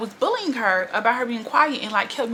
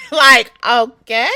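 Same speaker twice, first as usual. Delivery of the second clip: laughing; 2 semitones higher, 13 dB louder, much slower than usual. Real, not acted. Laughing outburst.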